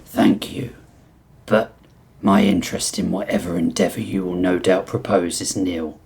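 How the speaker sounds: low, deliberate, formal reptile voice; reserved reptile prisoner. Acted. Reptile inmate turning down mob boss's scheme, low and formally reserved. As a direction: decline politely in a low, measured tone.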